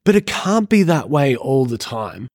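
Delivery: ordinary talk, nothing marked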